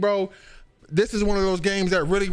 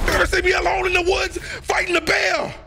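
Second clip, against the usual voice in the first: hyped yelling; 8 semitones higher than usual. Real, not acted. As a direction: yell it at the screen.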